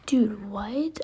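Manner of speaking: stunned whisper at the screen; shocked whisper. Real, not acted. Gamer stunned by a loss, whispering. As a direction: breathe it out in disbelief, no voice.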